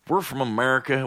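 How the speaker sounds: normal talking voice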